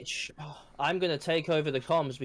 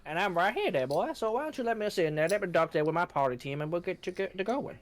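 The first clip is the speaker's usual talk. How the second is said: a hint of a Southern charmer; Southern accent, easygoing sweet talk; 4 semitones higher than usual. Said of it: "this is your southern accent, is it?".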